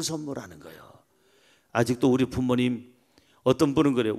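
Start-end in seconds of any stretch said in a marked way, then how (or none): none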